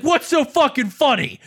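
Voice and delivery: high, indignant mock demand; retold angry yell. Brother challenging the laughing caller, retold angry yell. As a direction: imitate a brother annoyed by laughter. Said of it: "you called to yell at me".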